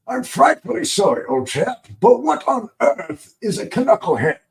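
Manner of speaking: high, goofy British gent voice. Acted. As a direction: put on a hammed-up British accent, pitched high. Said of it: "making fun of the British accent".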